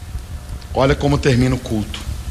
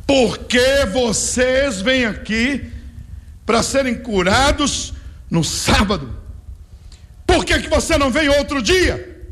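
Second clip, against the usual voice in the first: high, slow mocking voice of the accuser; scornful critic; 10 semitones higher, slower than usual. Preacher voicing the man who objects to Sabbath healing, high and slow. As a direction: drag it out, sneering at the healed. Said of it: "e o homem fala".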